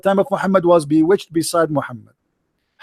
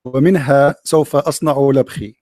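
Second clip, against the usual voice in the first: poem recitation; 6 semitones lower than usual. Acted. Recites improvised classical Arabic poem.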